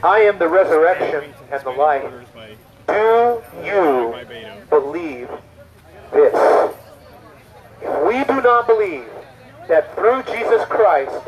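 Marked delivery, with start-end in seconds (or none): 0.0-6.7 low, measured words with long pauses; solemn answer. Acted. Jesus answers a mourner at the funeral, solemn. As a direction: space out the question word by word, sinking lower.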